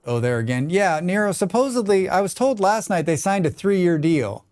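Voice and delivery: ordinary talk, nothing marked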